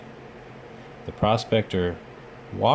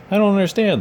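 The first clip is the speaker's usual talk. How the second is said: puzzled; 11 semitones higher, 6 dB louder, faster than usual. A narrator's reading. Puzzled prospector.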